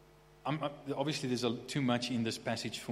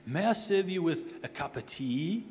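higher-pitched, polite British butler; 5 semitones higher than usual. Acted. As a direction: offer it in a mock British butler accent. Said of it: "inevitably with a British accent".

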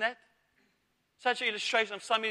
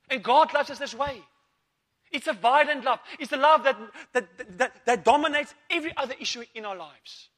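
impassioned preaching; 3 semitones higher, 5 dB louder than usual. Acted. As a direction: drive it home with force. Preaching at full force.

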